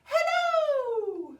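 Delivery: very high, loud, held-out "Hello"; as high as possible. Shown as a demonstration. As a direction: sing out "Hello" way up at the top of the voice. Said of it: "Let's say hello as high as we can".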